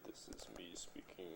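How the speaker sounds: whisper. Real, not acted. Whispering to the baby.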